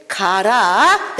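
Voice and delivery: drawn-out gangster growl of go; slow menacing go. Retold or imitated. Gangster ordering the preacher off, slow and menacing. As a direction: stretch the word like a threat.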